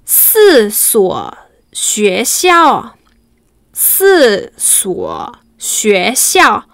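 "Si suo xue xiao" very slowly, "xiao" falling; slow sentence demo. Shown as a demonstration. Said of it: "veamos la primera oración".

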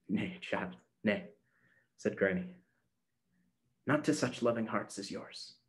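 put-on low, soft old woman voice; a gentle grandmother murmuring; old woman whispering. Granny answers softly.

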